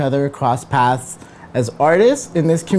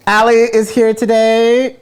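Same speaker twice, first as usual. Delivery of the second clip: loud, high hype announcement; announcer shout; 9 semitones higher, 5 dB louder than usual. Acted. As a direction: call it out like a game-show host. Host's big guest announcement.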